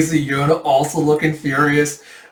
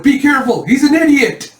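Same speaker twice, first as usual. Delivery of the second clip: high, loud exasperated yell; comic shouted warning; 13 semitones higher, 6 dB louder than usual. Acted. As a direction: yell the warning in mock panic. A comic warning about Jeha.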